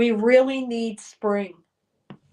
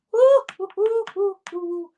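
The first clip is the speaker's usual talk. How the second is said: high, loud whoops, tapering off; string of woos at the freezing cold; cold-reaction whooping; 7 semitones higher, 5 dB louder than usual. Real, not acted. Reacting to ten degree cold.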